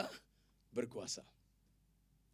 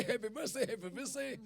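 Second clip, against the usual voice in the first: higher-pitched birthday chant; birthday well-wishing; 5 semitones higher than usual. Acted. Preacher imitating birthday well-wishers, cheerful chant.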